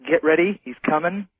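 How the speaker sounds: ordinary talk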